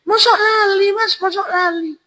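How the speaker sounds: very high falsetto woman; high put-on female voice; woman's voice. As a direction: pitch way up as the woman answering. Voicing the veiled woman.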